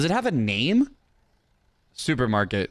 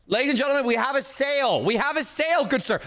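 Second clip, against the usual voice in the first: high-pitched showman's proclamation; triumphant announcement; 8 semitones higher, faster than usual. Pretend. Shopkeeper celebrating a sale, high triumphant announcement.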